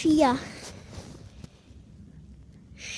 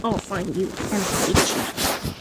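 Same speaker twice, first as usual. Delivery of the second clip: mock villain threat; slower than usual. Villain calling through the window, hammed-up slow threat. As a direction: stretch the threat out, sinking at the end. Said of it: "and he was like".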